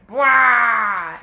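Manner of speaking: loud, drawn-out villain roar; Ganondorf's battle cry. Acted. Storyteller voicing Ganondorf facing Link, loud battle roar. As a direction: belt out a big mock bellow that falls away. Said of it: "Ganondorf was like".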